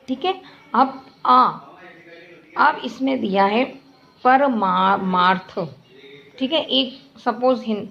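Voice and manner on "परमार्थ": परमार्थ drawn out slowly, syllable by syllable; slow spelled-out word. Shown as a demonstration. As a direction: stretch परमार्थ slowly so pupils hear each sound. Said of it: "बोल रहे हैं मुंह से परमार्थ". (परमार्थ को धीरे-धीरे खींचकर बोलना)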